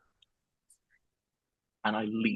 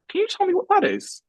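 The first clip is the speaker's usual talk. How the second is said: much higher, mocking the manager's question; squeaky imitation of the boss pointing at the screen.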